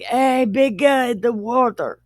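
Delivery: mock call to a big guy. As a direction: hail someone jokingly. Acts people calling out to God.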